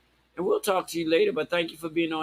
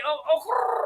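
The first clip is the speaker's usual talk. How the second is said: high-pitched rolled-r trill; trilled "okurrr" catchphrase; 10 semitones higher, 4 dB louder, slower than usual. Real, not acted. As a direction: roll a long r after a high "oh". Catchphrase trill while admiring guest.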